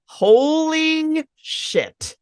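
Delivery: high, drawn-out stunned holy shit; a parent's over-the-top realisation; mock shock. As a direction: stretch it out in hammed-up disbelief. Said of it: "if a parent just says".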